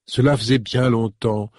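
usual speaking voice

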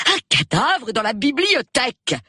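high, emphatic repeat; agitated insistence. Acted. Wife repeats the news to groggy husband, agitated. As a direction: drive the news home in a high voice.

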